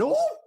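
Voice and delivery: high squealing mock gasp; a fan overcome, squealing; ecstatic gasp. Fan mock-squealing at [name]. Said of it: "having little out-of-body experiences going".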